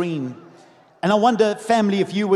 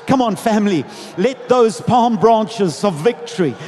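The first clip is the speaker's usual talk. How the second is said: loud, rousing preacher's delivery; full-force preaching; 2 semitones higher, 5 dB louder, slower than usual. Acted. Preacher rallies the congregation on the triumphal entry, full-force preaching. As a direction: preach to a big crowd with stretched-out emphasis.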